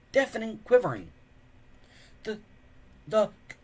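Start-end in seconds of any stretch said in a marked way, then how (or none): 2.2-3.6 high, loud, stuttering in fright; a boy stammering at a corpse that moves; terrified stutter. A narrator's reading. Hiccup stammers in fear at the corpse, high and loud. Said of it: "stuttered Hiccup".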